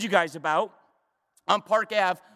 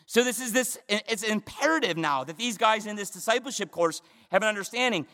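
ordinary delivery

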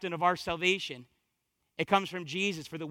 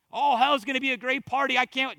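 raised, cocky party-goer voice; mock enthusiasm; 5 semitones higher, 4 dB louder than usual. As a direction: play a fool looking forward to it. Mocking those who welcome hell.

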